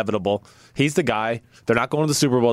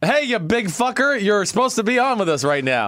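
mock angry voicemail; 8 semitones higher than usual. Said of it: "That was, that was an ugly voicemail".

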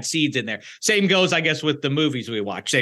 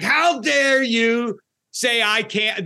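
high, drawn-out mock outrage; mock indignation; 8 semitones higher, much slower than usual. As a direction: imitate an overzealous defender taking offense. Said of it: "when you fight too hard".

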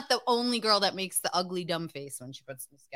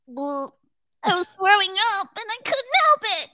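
imitating John; 11 semitones higher, 7 dB louder than usual. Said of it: "John's gonna call us in ... and go".

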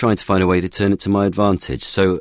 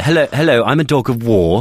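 Monty Python posh; 6 semitones higher than usual. Pretend. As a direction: play it like a silly Monty Python toff. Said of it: "sound like a Monty Python sketch".

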